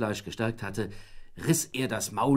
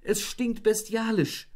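higher, slow, outraged; mock outraged cry; 11 semitones higher than usual. A narrator's reading. Wife crying out to husband in the shower. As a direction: cry it out in disgust. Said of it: "schrie sie".

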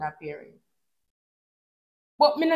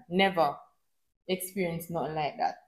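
much softer, lower repeat of the phrase; soft echo; 6 semitones lower, 8 dB quieter than usual. Shown as a demonstration. Vlogger repeats her point to viewers, much softer and lower. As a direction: let the repeat fall away quietly, for emphasis. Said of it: "repeated softer for emphasis".

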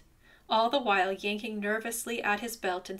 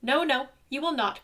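higher, louder cry; ant crying out; 4 semitones higher, 5 dB louder than usual. A narrator's reading. Little ant cries out defiantly at bear, higher and louder. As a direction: cry out in protest. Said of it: "cried Ant".

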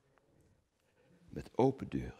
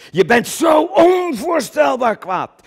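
furious shout; 12 dB louder, slower than usual. Acted. Describing Coen's rage.

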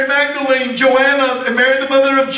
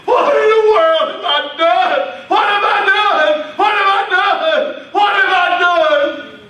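anguished cry; 5 semitones higher than usual. Acted. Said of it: "he began to weep bitterly".

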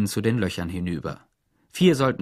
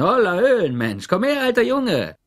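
hearty shout; 11 semitones higher, 6 dB louder than usual. A narrator's reading. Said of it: "brüllte er zur Tür herüber".

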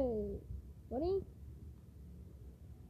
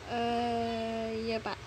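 ordinary delivery

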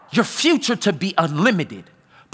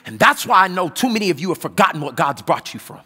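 usual speaking voice

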